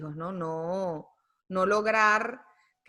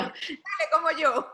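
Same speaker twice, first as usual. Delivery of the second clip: laughing; 9 semitones higher, 4 dB louder than usual. Real, not acted. Laughing at her own joke.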